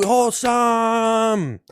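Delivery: long, high, stretched slogan; drawn-out cheer. Pretend. Cheering the catchword.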